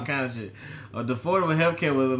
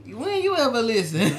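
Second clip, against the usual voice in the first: imitating [name]; 10 semitones higher than usual. Continues [name] impression.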